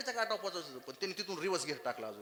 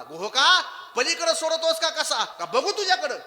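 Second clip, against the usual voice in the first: loud, higher angry shout at the boatman; a stern god loses his temper; 10 semitones higher, 11 dB louder than usual. Acted. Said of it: "प्रभू रामचंद्रजींना राग आला". (रागावलेला देव, मोठ्याने ओरडून)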